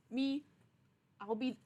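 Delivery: ordinary delivery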